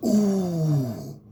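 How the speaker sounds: usual speaking voice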